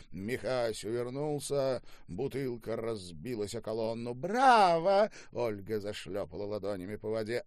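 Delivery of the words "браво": loud, high cheering; delighted cheer. Acted. Swimmer applauds the bottle throw, delighted cheer. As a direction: cheer on the mayhem.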